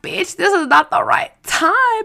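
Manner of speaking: high-pitched, drawn-out retold complaint; exasperated protest. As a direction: scold a cousin with bad timing, playfully exasperated. Retold exasperated reply to tapping cousin.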